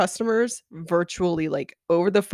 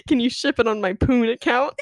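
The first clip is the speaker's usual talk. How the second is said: higher, giggly retold question; holding back laughter; 4 semitones higher than usual. Host retelling a customer's slip to co-host, laughing. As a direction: quote it while cracking up.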